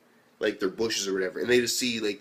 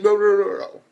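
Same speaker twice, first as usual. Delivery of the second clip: loud, high string of refusals; retold interruption; 9 semitones higher, 8 dB louder than usual. Minori cutting in to take over the lesson, loud mock protest. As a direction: burst in over someone, raised and higher.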